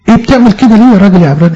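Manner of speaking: high, quick incredulous question; mock challenge. Acted challenge to a Hebrew reader. (سؤال استنكاري تمثيلي سريع وبنبرة عالية)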